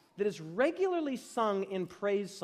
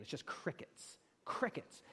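whispered aside; 4 semitones lower, 10 dB quieter than usual. Real, not acted. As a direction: drop to a dry whisper. Dry aside about the silence.

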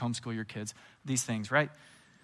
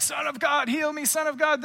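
high-pitched, needy cries for help; mock a clamoring supplicant calling out; hammed-up begging, voice pitched way up; pleading crowd; 12 semitones higher, 4 dB louder than usual. Voicing crowds begging Jesus for healing.

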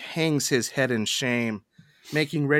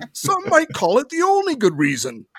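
incredulous emperor; 11 semitones higher, 3 dB louder than usual. Acted. Cornell reacts to Mycroft's confession.

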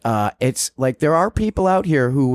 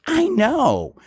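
much higher mock Liz; imitating Liz; 13 semitones higher than usual. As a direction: pitch it way up, smug and oblivious. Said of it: "And she goes".

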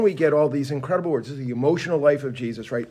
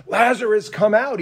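mock angry command, raised pitch; angry Jesus; 6 semitones higher, 4 dB louder than usual. Jesus, angry at death, calls into the cave, bursting forth. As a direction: burst forth with a touch of anger. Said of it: "in a bursting forth angry voice".

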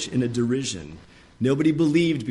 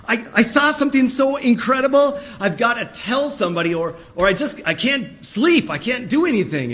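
very high, loud gush of excitement; bursting excitement; 10 semitones higher, 6 dB louder than usual. Retold or imitated. Preacher acting a believer too thrilled by the Word to sleep, high and gushing. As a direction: spill over like you can't hold it in. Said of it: "You're like".

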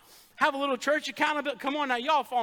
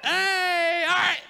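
loud call back to order; shout; 6 semitones higher, 6 dB louder, much slower than usual. Real, not acted. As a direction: shout to pull the room back to the sermon. Preacher shouts congregation back to topic.

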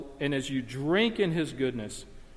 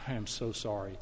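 quiet apology; 6 semitones lower, 10 dB quieter than usual. Retold or imitated. Husband apologizes for no power.

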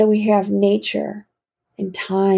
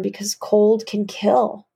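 usual speaking voice

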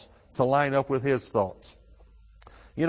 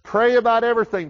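"Pray about everything" said high, loud and fast, weight on "about"; loud emphasis; 9 semitones higher, 7 dB louder, faster than usual. Shown as a demonstration. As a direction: proclaim it, punching "about". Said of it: "Let your requests be made known to God".

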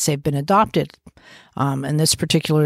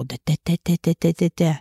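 put-on baby babble; faster than usual. Shown as a demonstration. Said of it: "going into the babble".

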